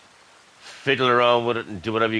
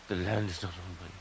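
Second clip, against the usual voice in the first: softer, deflated repeat of the warning; resigned mutter; 3 semitones lower, 10 dB quieter than usual. Real, not acted. Gamer repeats the error message to himself, quiet and resigned. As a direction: say it again under your breath, giving up.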